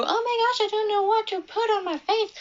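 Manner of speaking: high, fast ditzy panic, clearly put on; mocking Gracie. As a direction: play a clueless girl, squealing in mock panic. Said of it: "She didn't know".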